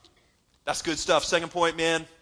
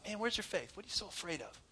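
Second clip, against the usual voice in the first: gentle Jesus; 8 dB quieter than usual. Retold or imitated.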